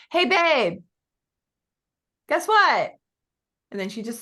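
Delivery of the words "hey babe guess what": high, loud playful call to husband; teasing wife call. Retold or imitated. Instagram lady calling her husband, playful and high. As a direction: call out to your husband in the other room. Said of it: "she pretends she's talking to her husband".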